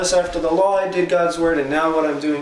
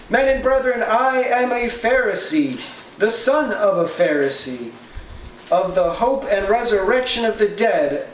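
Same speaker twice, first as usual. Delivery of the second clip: cried out; 7 semitones higher than usual. Retold or imitated. Paul to the divided council, crying out high. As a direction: call out over the whole council. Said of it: "he cried out in the council".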